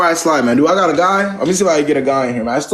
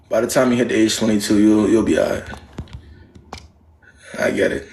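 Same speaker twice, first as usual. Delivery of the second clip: usual speaking voice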